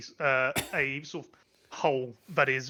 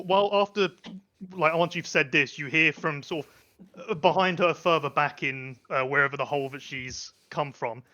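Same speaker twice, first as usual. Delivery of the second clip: ordinary delivery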